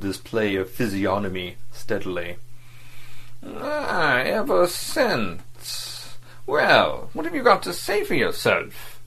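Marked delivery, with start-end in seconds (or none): 3.4-6.2 high, very slow sneering repeat of ever since; mocking echo. A narrator's reading. Diplomat mocking the agent's answer, drawn-out sneer. Vladimir mocks Verloc's words. 6.5-9.0 high, sharp challenge to Verloc; sharp demand. A narrator's reading. Diplomat confronting Verloc, sharply. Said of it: "he asked sharply".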